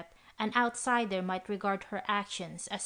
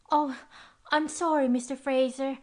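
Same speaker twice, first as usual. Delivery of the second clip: put-on young girl voice; 6 semitones higher, 5 dB louder than usual. A narrator's reading. Girl apologising, high.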